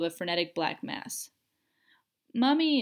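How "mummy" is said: put-on loud, high child's scream for her mother; a frightened little girl screaming for help; terrified scream. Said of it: "the little girl screamed".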